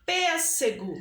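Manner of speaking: teacher's strong opening beat on "Pêssego"; stressed first syllable. Shown as a demonstration. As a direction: punch the Pês in "Pêssego", let the rest fall. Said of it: "o pês".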